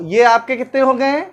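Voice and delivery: usual speaking voice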